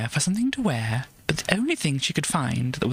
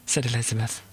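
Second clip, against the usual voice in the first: ordinary delivery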